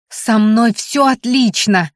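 slow, drawn-out call; shouting out. A narrator's reading. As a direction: call it out after someone. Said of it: "выкрикнула я".

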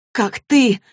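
sharp loud retort blurted out. A narrator's reading. Clary snapping back at Jace, blurted and loud. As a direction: fire it back at him. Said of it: "выпалила она".

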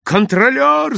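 high, loud desperate call; urgent cry for help; all-out shout. A narrator's reading. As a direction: yell for the conductor with all your strength. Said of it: "закричал я, что было сил".

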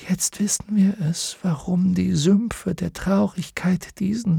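fading breath of a sinking horse; dying horse whispering. Acted. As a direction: Artax saying farewell as he sinks, whispering.

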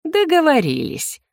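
high, drawn-out, pleased agreement; smug satisfaction. A narrator's reading. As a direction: seal the deal with gleeful satisfaction. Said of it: "удовлетворённо хмыкнуло вредное привидение". (довольно, нараспев)

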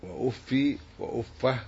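وأفي وأفه slowly, each opening on u; damma on both. Shown as a demonstration. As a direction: say wa-uffi, wa-uffah deliberately. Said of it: "بضم همزتهما".

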